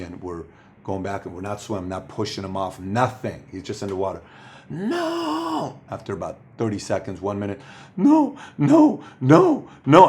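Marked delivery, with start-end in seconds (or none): 4.7-5.8 put-on high, long child's wail of no; a toddler's high-pitched no, held long; imitating panicked toddler. 8.0-10.1 put-on high, pleading child's no no; a frightened kid begging to get out; a toddler's high no no no, loud at the end; imitating scared toddler. Panicking toddler in the water.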